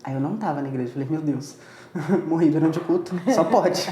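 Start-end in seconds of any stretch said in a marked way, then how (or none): 1.1-3.9 says it with a laugh, louder, half joking; laughing disbelief. Retold or imitated. Speaker retelling waking in an empty place, laughing disbelief. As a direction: laugh through the shock of dying mid-service.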